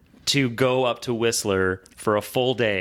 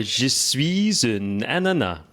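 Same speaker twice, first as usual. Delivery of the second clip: slow, deliberate French; speaking French; much slower than usual. Shown as a demonstration. Guest says a little French on request, slow French. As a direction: recite French slowly, word by word. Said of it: "en français for me".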